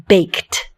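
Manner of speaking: baked, the T at the end clipped and audible; baked with a crisp final T. Shown as a demonstration. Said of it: "Do you hear the T at the end?".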